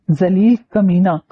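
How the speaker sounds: usual speaking voice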